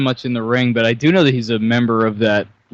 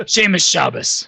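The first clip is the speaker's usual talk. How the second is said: punchline delivery; 5 semitones higher, slower than usual. Pretend. Joke punchline.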